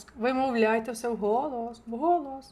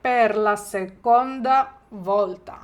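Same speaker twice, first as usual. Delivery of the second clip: slow, louder, stress on seconda; slow model phrase; 5 dB louder, slower than usual. Shown as a demonstration. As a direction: drill it slowly for repeating. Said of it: "я вам допоможу".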